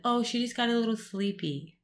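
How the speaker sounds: mock a doctor lightly brushing it off; dismissive doctor. Doctor's downplaying retold.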